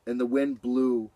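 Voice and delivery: plain reading voice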